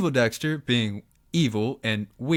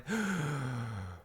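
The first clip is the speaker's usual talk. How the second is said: gasp; 10 dB quieter than usual. Acted. Emperor realizes a terrible mistake.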